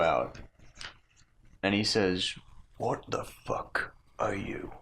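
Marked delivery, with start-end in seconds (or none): 2.8-4.7 slow, hushed menacing question; whispered threat. Acted. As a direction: whisper it right into his face. Orc hisses at the intruder.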